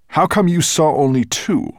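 loud, high suspicious challenge; demanding dragon. A narrator's reading. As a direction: challenge the servant sharply, falling at the end. Dragon challenges the dwarf's report.

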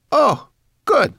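very high, loud creature cheer; high-pitched little brownie, delighted; Sorrel, pleased. A narrator's reading. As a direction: squeal it with relief. Voices Sorrel.

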